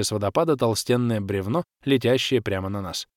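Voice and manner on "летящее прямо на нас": usual speaking voice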